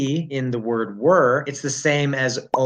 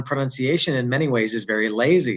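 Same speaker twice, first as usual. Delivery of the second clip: ordinary delivery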